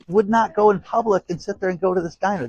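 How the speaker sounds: ordinary talk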